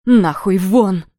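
slow, very loud furious roar; roaring like a wounded lion. Acted. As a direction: roar it out, wrecking the room. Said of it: "как рык раненого льва". (рёв раненого льва)

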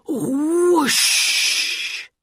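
loud high rushing whoosh; long explosive burst of magic smoke; imitated whoosh. A narrator's reading. Storyteller making the ring's sudden magic noise, loud whoosh.

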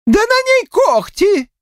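high, drawn-out woman's voice; shocked exclamation. A narrator's reading. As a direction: cry out in horror, high and slow. Village woman shocked at claws. (потрясённо, высоким бабьим голосом)

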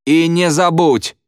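high, drawn-out command; bullying order. A narrator's reading. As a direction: call it out as a threat.

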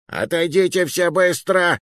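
high, frantic hostage-taker's yell. A narrator's reading. Hostage-taker warning everyone back, yelling. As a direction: scream the order for the whole town to hear. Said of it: "заорал он на весь Сент-Пьер".

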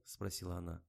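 usual speaking voice

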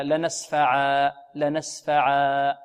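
لنسفعا ending in a long drawn alif, no nun; وقف بالألف. Shown as a demonstration. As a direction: stretch لنسفعا slowly, stop on a long alif. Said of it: "نقف عليه هكذا". (الوقف على لنسفعا بالألف)

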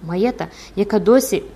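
usual speaking voice

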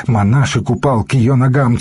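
ordinary delivery, nothing marked

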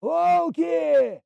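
high shouted alarm. Acted. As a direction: yell out the warning. Alarm at wolf attack. (тревожный крик)

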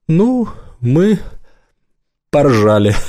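high, halting, barely holding it in; suppressed laughter. A narrator's reading. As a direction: struggle not to burst out laughing. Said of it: "Даган не выдержал и захохотал".

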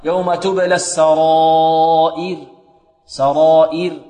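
slow chanted السرائر, long aa and thin final ر; madd and light ra. Shown as a demonstration. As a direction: hold the alif of السرائر, then a clear i and light ر. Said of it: "الترقيق، كما في قوله لقادر".

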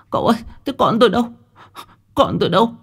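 high, fast, urgent questions; desperate for her child; anxious asking. Acted. Mother at the riverbank asking where her child is, anxious.

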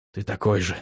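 soft low whisper, such contempt. Acted. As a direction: breathe it out, quiet and bitter. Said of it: "прошептал он".

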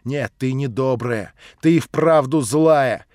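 loud, slow scolding; angry reproach. Acted. Narrator scolds Nelly loudly. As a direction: scold her hard. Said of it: "вскричал я".

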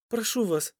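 high, pleading woman; imploring. A narrator's reading. Лера appeals to the captor, imploring. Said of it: "взмолилась Лера".

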